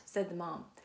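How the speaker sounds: ordinary delivery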